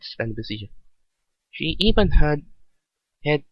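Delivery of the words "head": ordinary delivery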